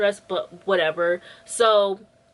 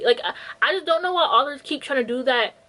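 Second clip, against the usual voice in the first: ordinary delivery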